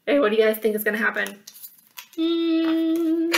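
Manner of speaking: lively read-aloud storyteller; animated children's story reading; story narration. A narrator's reading. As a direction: read it like a picture book to kids. Reads picture book aloud.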